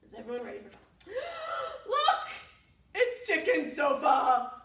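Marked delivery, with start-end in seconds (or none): 1.0-1.8 sharp high gasp; surprised gasp. Acted. Reader gasping at Chicken Sofa. 1.9-4.5 loud, high, delighted; excited reveal. Acted. Reader showing the children Chicken Sofa.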